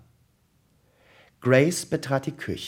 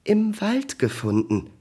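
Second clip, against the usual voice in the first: much higher, pre-sneeze voice; fighting a sneeze; 10 semitones higher, 3 dB louder than usual. A narrator's reading. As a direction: squeeze the words out before another sneeze. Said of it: "musste kurz daraufhin noch einmal niesen".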